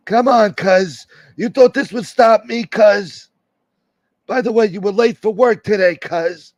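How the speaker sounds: high, slow, mocking drawl on "cuz"; Rob Jean's taunting voice. Streamer imitates Rob Jean taunting his enemies, high and mocking. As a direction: play the cocky rival laughing off the club. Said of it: "and he'll laugh at you".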